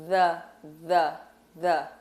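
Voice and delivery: the, the, the with tongue out, buzzing th; voiced th. Shown as a demonstration. Teacher drilling the voiced th sound for a student. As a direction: repeat the with a voiced th, slowly. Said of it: "que sai este som".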